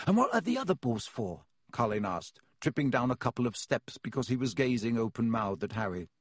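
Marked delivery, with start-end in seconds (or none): 0.1-1.5 put-on much higher, keen young fan voice; bursting to show his photo; eager young boy. A narrator's reading. Colin voiced.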